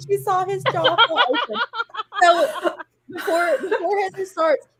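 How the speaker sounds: narration breaking into laughter; struggling to read for laughing; laughing while reading. Real, not acted. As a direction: read through giggles, voice up high. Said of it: "narrator laughing through reading".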